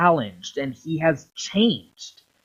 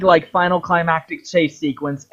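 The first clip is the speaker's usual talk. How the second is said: normal talking voice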